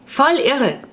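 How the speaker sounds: put-on high-pitched boyish enthusiasm; excited call. A narrator's reading. Jonas cheers about his new roommate, calling out excitedly. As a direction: exclaim it with delight, pitch jumping up. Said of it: "rief Jonas".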